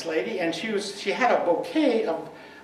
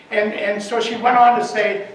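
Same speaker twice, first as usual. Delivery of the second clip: normal talking voice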